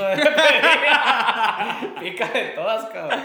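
loud laughter breaking through the quote; cracking up at the punchline; laughing. Real, not acted. Quoting the boss's order while laughing loudly.

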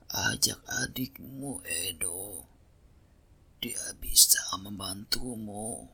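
weak breathy whisper of a sick father. Acted. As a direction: whisper slowly, too ill to speak up. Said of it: "jawab ayahnya".

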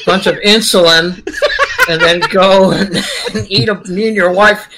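words bubbling with laughter; laughing while talking. Real, not acted. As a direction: joke it out through your own laughs. Joking plan, laughing through it.

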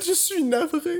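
leise und düster, viel leiser; somber French. Retold or imitated. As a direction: sag es wie eine Trauernachricht. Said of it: "the most somber way to say that".

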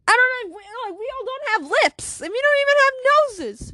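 high, squealed objection; high-pitched, worked-up rebuttal; exasperated protest. Acted. Character protests they lack lips.

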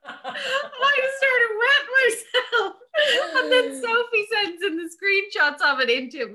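very high, real laughter breaking up the words; helpless laughing. Real, not acted. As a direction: barely get the story out for laughing. Cracking up while retelling story.

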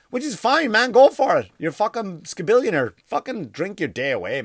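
high, loud sarcastic cheer; mock encouragement. Real, not acted. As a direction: egg them on, over the top.

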